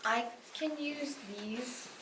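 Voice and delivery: normal talking voice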